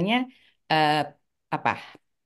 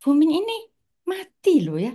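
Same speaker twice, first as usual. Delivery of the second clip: retelling the brothers' startled cry, much higher and louder; alarmed children's outcry, raised high and loud; shocked cry; 9 semitones higher, 4 dB louder than usual. Retold or imitated. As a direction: gasp it out as if you just saw him fall, voice jumping up high. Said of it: "mereka bilang".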